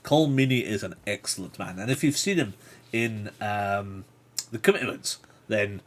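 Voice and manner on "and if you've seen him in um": ordinary delivery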